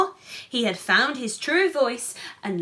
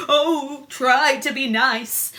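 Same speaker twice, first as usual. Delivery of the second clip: whimpered; 3 semitones higher, 6 dB louder than usual. Acted. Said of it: "he whimpered".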